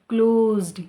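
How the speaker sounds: "Closed" loud, final d hit hard; correcting the student, loud on the d; stress the ed. Shown as a demonstration. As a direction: punch the ending of "closed". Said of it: "ईडी पे भी focus करें".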